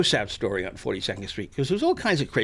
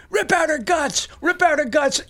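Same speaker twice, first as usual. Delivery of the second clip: much higher eager urging chant; egging on; 13 semitones higher, 4 dB louder than usual. Retold or imitated. Heckler urging the monster further, high eager chant. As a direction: push the monster on gleefully. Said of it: "he's going".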